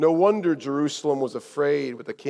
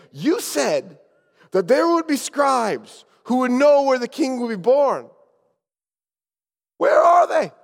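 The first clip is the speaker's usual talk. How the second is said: high, raised confrontation; accusing demand; 8 semitones higher, 5 dB louder than usual. Acted. Travelers confronting their guide over missing scribes, accusing. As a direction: demand an answer, louder on the final question.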